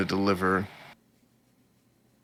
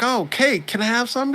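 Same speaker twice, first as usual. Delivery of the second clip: put-on high, eager little girl asking for cake. Retelling the anime, voicing the girl who wants cake, high and eager. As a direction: pitch it way up like a kid begging. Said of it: "She's like".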